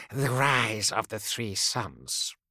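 slow, detached answer; distant seer voice. Acted. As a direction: speak as if in a trance. Ashokai answers, eyes closed.

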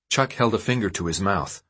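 ordinary delivery

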